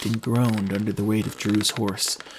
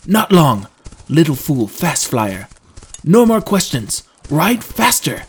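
loud, high, impatient commands; 3 semitones higher, 7 dB louder, slower than usual. Acted. Trendon shutting down Drew's questions on the ride, impatient. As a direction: cut off the questions forcefully. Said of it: "Trendon's impatient attitude".

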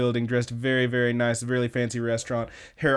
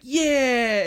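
high, drawn-out cheer; mock-exultant "yeah", pitched way up; triumphant; 10 semitones higher than usual. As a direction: cheer like you're nailing it. Acting out gleeful vacuuming.

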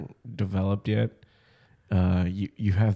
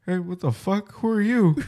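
high, startled challenge; rising mock-shocked questioning; mock bewilderment; 10 semitones higher than usual. Acting out the dad facing his lookalike, high and startled. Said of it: "just like".

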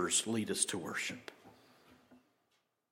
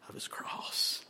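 whisper; 7 dB quieter, slower than usual. Acted. Reverent close of scripture verse.

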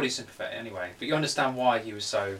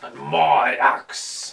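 louder, very slow two-stage Gimli line; Gimli impression; 6 dB louder, much slower than usual. Friend on his knees doing Gimli's line, slow and louder. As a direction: drag it out like a proud dwarf. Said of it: "How does it do?".

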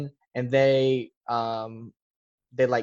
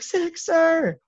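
high, loud yelled report; screamed report; 3 dB louder than usual. Retold or imitated. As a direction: shout it breathless at the instructor. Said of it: "I just screamed at him".

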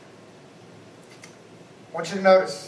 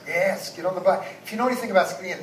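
ordinary delivery

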